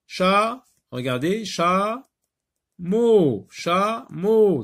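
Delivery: syllables "chat" and "mot" called high and loud; emphatic syllables. Shown as a demonstration. Teacher building a word, calling syllables high and loud. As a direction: hammer each syllable high. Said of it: "building the word chaton".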